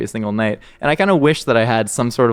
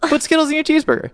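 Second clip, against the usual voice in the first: much higher, faster squeaky take on Skittles; mock squeaky repeat; faster than usual. Shown as a demonstration. Friend mocks eating Skittles in a cheeseburger, in a silly high voice. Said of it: "repeated in mock voice".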